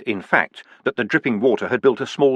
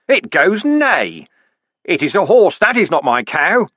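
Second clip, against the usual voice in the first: higher and louder, slow sing-song; hammed-up recital; 6 semitones higher, 4 dB louder than usual. Vimes reciting the horse page at the dwarfs, high and loud. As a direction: bray the book line at them.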